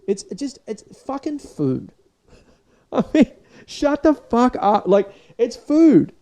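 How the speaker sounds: high, loud, slow disbelief; exasperated rant. Real, not acted. Vlogger mocking MasterChef hype, exasperated high rant. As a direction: rant in laughing disbelief that it's just food. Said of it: "ranting at cooking shows".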